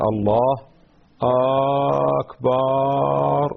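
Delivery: "الله أكبر" with very long drawn-out vowels; stretched-out takbir. Shown as a demonstration. Teacher showing how some people say the takbir, stretched out. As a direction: drag out both vowels of "أكبر", very slowly. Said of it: "بعض الناس يقول".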